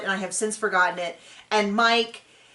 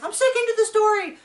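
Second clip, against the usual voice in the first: high, strained mock hostage voice; frightened hostage; 11 semitones higher, 4 dB louder than usual. Playing the husband as a scared hostage, high and strained. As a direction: squeak it out like a scared captive. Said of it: "And he's like".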